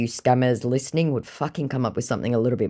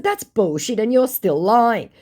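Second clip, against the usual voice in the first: higher, louder scolding; retold angry reply; 8 semitones higher, 5 dB louder than usual. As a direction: tell him off with contempt.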